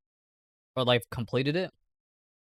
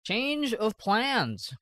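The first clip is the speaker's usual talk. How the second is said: stretched slow and high, announcing; slow drawn-out announcement; 6 semitones higher, 3 dB quieter, much slower than usual. Acted. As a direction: drag out each word for drama. Drawn-out announcement of new plans.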